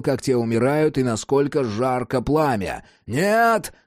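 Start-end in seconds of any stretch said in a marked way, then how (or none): 3.1-3.7 high, drawn-out cry of refusal; yelling. A narrator's reading. Jack fears the sunlight's flames, yelling no. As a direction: yell it in alarm into a microphone. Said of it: "заорал он в микрофон".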